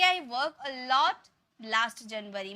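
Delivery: very slow, careful model sentence. Shown as a demonstration. Teacher gives the corrected sentence without in. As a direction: set each word out for learners. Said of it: "हम simply बोलेंगे".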